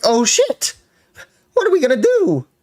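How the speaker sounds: high-pitched hammed-up panic; squeaky-high fake freak-out; mock panic. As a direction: imitate them panicking, voice shooting way up. Acts out their panicked reaction.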